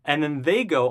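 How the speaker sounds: ordinary delivery, nothing marked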